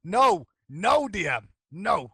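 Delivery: high, loud, emphatic no; loud refusal. Real, not acted. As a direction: shut it down loudly. Refusing to discuss the meditation.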